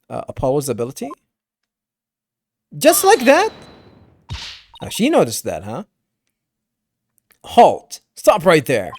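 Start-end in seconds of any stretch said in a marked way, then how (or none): 2.8-3.5 high, loud burst of disbelief; incredulous. Acted. Reacting to the bracelet theft, loudly incredulous. 7.4-8.9 loud, sudden order to stop; commanding call. Acted. Stopping the caretaker leaving, commanding call. Character calls someone to stop.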